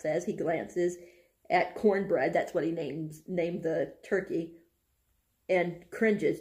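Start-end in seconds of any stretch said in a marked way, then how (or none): none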